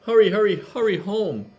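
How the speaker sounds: high, loud call after her; brother calling. A narrator's reading. Little brother calls Tilly home, high and loud. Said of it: "her brother called".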